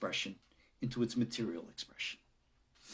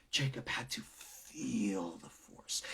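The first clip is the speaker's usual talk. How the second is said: hushed and slow; 3 semitones lower, 7 dB quieter, slower than usual. Acted. Dramatic storytelling.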